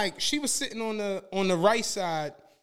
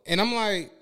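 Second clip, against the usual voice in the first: usual speaking voice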